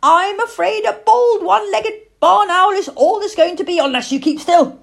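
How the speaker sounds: loud, high-pitched telling-off; exasperated young lady. A narrator's reading. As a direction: scold the fidgety sitter with rising impatience.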